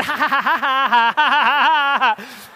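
put-on high, gleeful child's giggle, slowing down; imitated giggling. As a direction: giggle like a delighted little girl. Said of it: "she's giggling".

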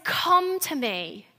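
slow, softened, intimate invitation. Acted. Preacher showing Jesus' call to come close, intimate. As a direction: draw it out gently, up close. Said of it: "In intimacy".

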